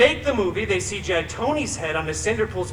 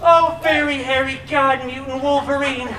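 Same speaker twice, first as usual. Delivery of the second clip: desperate pleading; 4 semitones higher than usual. Acted. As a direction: plead like a wronged fairy-tale heroine. Cinderpool pleads dramatically to Wolverine.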